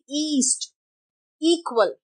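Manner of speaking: ईस्ट, इक्वल opening on a long 'ee'; long E sound. Shown as a demonstration. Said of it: "जहां पे ई आता है". (ईस्ट, इक्वल में लंबी ई)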